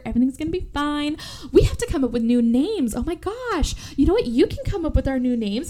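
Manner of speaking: high, sugary sing-song chatter; high chipper voice of a deranged twin; creepy cheerful. Retold or imitated. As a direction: gush at her, high and bubbly. Twin's chipper, unhinged monologue.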